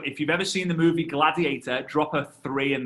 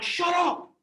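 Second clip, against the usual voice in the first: annoyed heckle; 12 semitones higher than usual. Retold or imitated. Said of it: "The guys in front are like".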